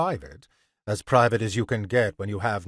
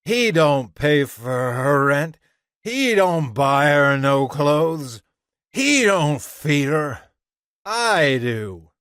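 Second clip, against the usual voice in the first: louder, drawn-out protest; indignant grievance; 4 semitones higher, 5 dB louder, slower than usual. A narrator's reading. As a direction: state the grievance forcefully, dragging the words. Man argues he supports her.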